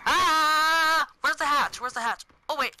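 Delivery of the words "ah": long, high, loud "aah"; drawn-out scared shriek; frightened scream. Real, not acted. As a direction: scream in sustained panic. Attacked while seeking hatch.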